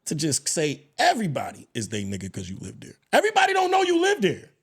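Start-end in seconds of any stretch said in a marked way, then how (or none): 3.1-4.5 emphatic outburst. Real, not acted. Heated rant about neighborhood pass.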